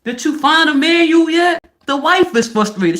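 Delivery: usual speaking voice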